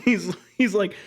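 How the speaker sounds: laughs through the words; cracking up mid-retell; laughing. Real, not acted. Friend cracking up retelling Luthor's line, laughing.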